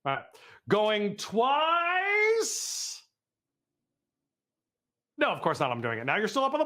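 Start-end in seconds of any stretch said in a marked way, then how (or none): 0.7-3.0 high, very drawn-out last word; auctioneer's call. Pretend. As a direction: hold the final word, climbing high.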